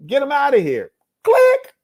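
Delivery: very high, loud send-off ending in a squealed click; hammed-up hang-up, voice leaping high; exaggerated dismissal. Man cutting off a worthless conversation, loud hammed-up dismissal. Replays hanging up with sound effect.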